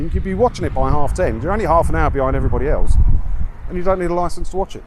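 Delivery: usual speaking voice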